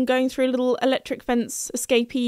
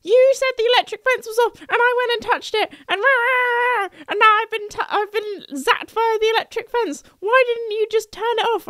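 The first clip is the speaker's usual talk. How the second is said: imitating angry dad; 11 semitones higher than usual. Zapped dad complains to his daughter about the fence, mock angry. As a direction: whine it like a furious, put-upon dad. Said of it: "a very angry dad".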